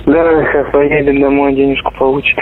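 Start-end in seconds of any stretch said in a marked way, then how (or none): none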